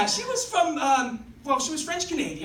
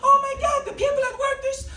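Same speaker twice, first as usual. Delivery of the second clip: high, exasperated girlfriend impression; [name]; 12 semitones higher, 6 dB louder, much faster than usual. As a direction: complain in a high put-on voice. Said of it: "doing her impression of stupid people".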